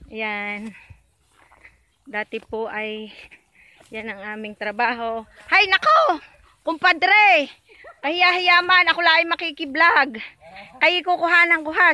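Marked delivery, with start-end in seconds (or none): 5.5-11.9 calling out. Real, not acted. Calling out to kumpadre in field.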